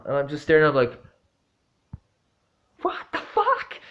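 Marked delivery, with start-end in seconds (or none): 2.8-3.8 a much higher, stunned what the fuck; baffled. Retold or imitated. Narrator reacting to the nodding old man, stunned and high. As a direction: squeak it out in disbelief.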